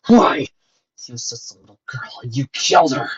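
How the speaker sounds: shouted accusation, dipping then erupting; anguished yell. A narrator's reading. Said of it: "as he yelled at his stupid".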